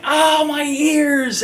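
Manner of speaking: high, drawn-out mock wail of pain; hammed-up bystanders clutching their ears; mock pained cry. Bystanders hurt by her screaming, in a high mock wail. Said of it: "everyone's like".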